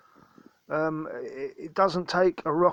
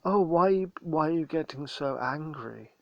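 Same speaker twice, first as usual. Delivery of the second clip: soft, mocking voice of a calm meat eater, dropping quieter; a put-on sleepy, wounded voice mocking his critics; mock placid meat eater; 4 dB quieter than usual. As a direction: sneer it out like a smug, laid-back meat eater.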